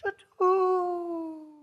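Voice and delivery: long, fading imitated whistle; train whistle in the wind. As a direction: let the high toot drift away on the wind. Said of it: "you hear it in the wind".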